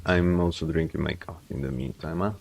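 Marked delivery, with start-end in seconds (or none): none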